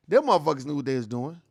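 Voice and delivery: ordinary talk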